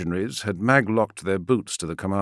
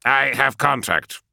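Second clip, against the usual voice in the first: vox operator voice; 7 semitones higher, 4 dB louder, slower than usual. Acted.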